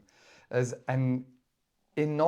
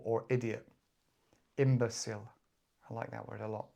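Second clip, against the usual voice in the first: ordinary talk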